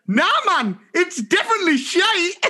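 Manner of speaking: put-on high, insistent Sunderland child; broad Mackem accent. Four-year-old insisting to teacher after sniffing, broad Mackem accent. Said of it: "with a very broad Macam accent".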